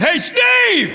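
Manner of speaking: very high, hammed-up shout; crowd member's holler. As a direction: holler the name with full silliness. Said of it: "people we don't even know are going".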